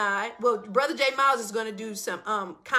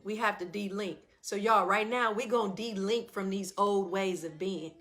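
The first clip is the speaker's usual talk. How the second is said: softer, calm breath-guide voice; guided breathing; 2 semitones lower, 4 dB quieter than usual. Acted. Guiding viewers through de-linking breaths, softly. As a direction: lead everyone gently through the breaths.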